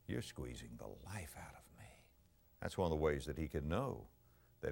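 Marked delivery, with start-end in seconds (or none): 0.1-1.9 anguished whisper. Acted. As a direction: breathe it out like a dying man's prayer. Preacher repeats David's prayer whispered.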